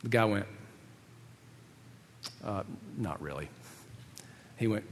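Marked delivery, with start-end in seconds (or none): 2.4-3.5 very low, hushed mumbled admission; meek reply. Retold or imitated. Student admitting to professor he isn't excited, meek reply. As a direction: imitate a sheepish student, barely audible.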